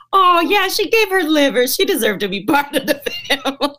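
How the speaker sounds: high, loud and delighted, breaking into laughter; gleeful triumph. Retold or imitated. Actress reacting to being welcomed into the family, gleeful triumph. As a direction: crow it proudly, laugh bubbling up.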